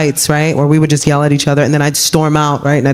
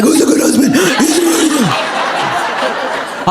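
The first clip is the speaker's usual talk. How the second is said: high, slow pet-praise voice; talking to a pet; 10 semitones higher, much slower than usual. Retold or imitated. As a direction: coo like praising a dog. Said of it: "sounding like I'm talking to a pet".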